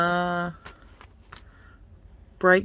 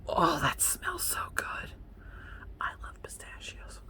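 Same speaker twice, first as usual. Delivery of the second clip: savoring whisper; 11 semitones higher, 12 dB quieter than usual. Real, not acted. Vlogger smelling pistachio hand cream, savoring whisper. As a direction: whisper it, swooning over the scent.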